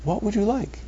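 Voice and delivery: high, eager, fawning host voice offering coffee; teacher mocks a friendly warden offering coffee, high and gushing; sugary friendly warden. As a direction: play the too-friendly jailer pushing drinks on you. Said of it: "The warden is just like".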